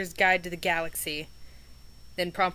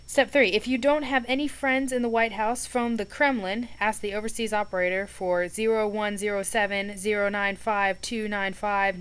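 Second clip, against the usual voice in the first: reading aloud; 3 semitones higher, 5 dB louder than usual. A narrator's reading. Reads the list aloud.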